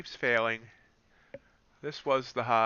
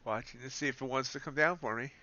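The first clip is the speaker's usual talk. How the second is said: usual speaking voice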